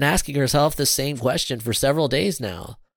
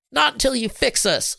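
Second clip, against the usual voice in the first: high-pitched, insistent refusal; demanding; 13 semitones higher than usual. A narrator's reading. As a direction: refuse firmly, voice pitched right up. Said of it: "she demanded".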